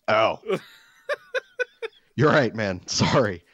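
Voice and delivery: laughing, whimpering sorry; sheepish laughing apology. Retold or imitated. Apologizing to the lifeguard, sheepish laugh-whimper. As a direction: laugh through an embarrassed apology.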